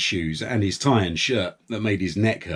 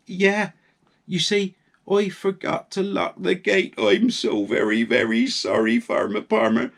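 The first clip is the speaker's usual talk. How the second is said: lightly voiced sorry wife, higher; a gentle wife owning up, a hint of a higher voice; Missus Farmer Palmer voice; 9 semitones higher than usual. Wife voice again.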